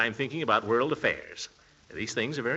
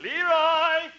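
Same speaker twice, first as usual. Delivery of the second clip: calling upstairs. Acted. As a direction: shout his name so it carries upstairs. Uncle calls nephew from downstairs.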